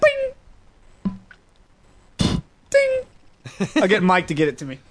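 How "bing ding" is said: sound effect ding. Retold or imitated. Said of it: "You need that sound effect".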